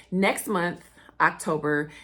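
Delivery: ordinary delivery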